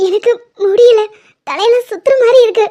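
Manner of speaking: overwhelmed, tearful and rising in pitch; crying. Acted. Persi to her machan, head spinning from the news, crying. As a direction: sob that it is all too much. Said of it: "அழாத".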